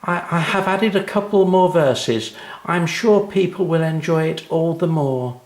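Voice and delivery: put-on high, confident little girl. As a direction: speak like a proud young girl. Anna voiced in story.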